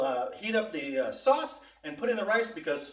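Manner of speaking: ordinary delivery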